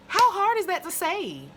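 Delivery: high, loud rhetorical question; exasperated shout. Real, not acted. Reviewer berating Faye, high and loud. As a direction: yell it in disbelief.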